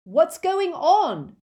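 loud, high, slow yell; drawn-out shout from behind a wall; shouted question. A narrator's reading. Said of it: "shouted Ron again".

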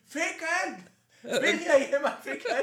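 high laughing bird cry; horny bird call. Retold or imitated. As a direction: shriek it like a bird in a tree. Voicing bird shouting in tree.

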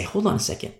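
ordinary talk

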